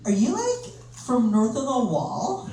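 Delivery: put-on high, drawn-out American girl voice; American date. Date to the comedian about Jon Snow, as a mock American girl. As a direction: put on a gushing American woman, stretching the words. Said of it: "she was like".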